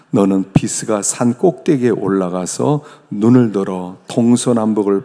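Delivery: loud, solemn Bible reading; scripture reading. Acted. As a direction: read the scripture out with full weight. (힘 있게 성경 봉독)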